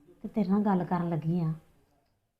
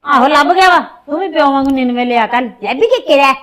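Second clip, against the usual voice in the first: angry shouting; 4 semitones higher, 14 dB louder than usual. Acted. Mother snaps back at son.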